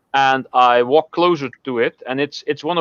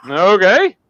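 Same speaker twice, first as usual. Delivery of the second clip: louder, higher questioning okay, rising at the end; puzzled okay; 5 semitones higher, 6 dB louder than usual. Acted. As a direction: answer as if baffled by what was heard. Puzzled reaction acted in a joke.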